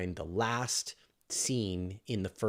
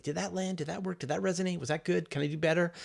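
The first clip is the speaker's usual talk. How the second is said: self-questioning; 8 semitones higher, faster than usual. Retold or imitated. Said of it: "and I sort of think".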